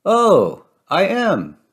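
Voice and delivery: high-pitched "oh" of discovery, then falling; delighted storybook realization, high then settling; dawning realization. A narrator's reading. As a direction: realize it aloud, pitched well up. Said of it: "staring at herself".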